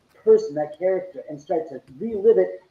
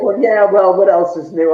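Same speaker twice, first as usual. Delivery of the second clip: much louder, mock-unimpressed; sarcastic shrug; 2 semitones higher, 9 dB louder than usual. Homeowner mocking the unimpressed visitors, loud and sarcastic. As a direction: toss it off like it happens every day. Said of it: "it's like".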